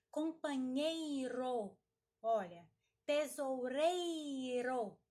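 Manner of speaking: "companheiro" and "tesoureiro" with a long, loud "ei"; slow, emphatic "nhei" and "rei" in "companheiro", "tesoureiro"; strong syllable drawn out. Shown as a demonstration. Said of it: "aquela palavra que nós mais arrastarmos".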